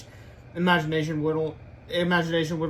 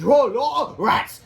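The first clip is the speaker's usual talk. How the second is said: loud, high cry of dismay; hammed-up alarm; cartoon dismay; 8 semitones higher, 8 dB louder, much slower than usual. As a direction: wail it as a foiled character. Comic characters cry out.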